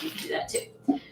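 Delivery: ordinary talk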